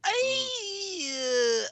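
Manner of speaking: very high, stretched-out hesitation sliding down; high-pitched stalling; drawn-out hesitation. Real, not acted. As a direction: squeal out a long, unsure "I". Drawn-out hesitation before answering.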